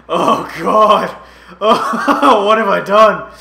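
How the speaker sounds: loud, high laughing cry; laughing in disbelief. Real, not acted. Gamer realizing his trade mistake, loud laughing disbelief. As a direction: laugh out the horror at your own blunder.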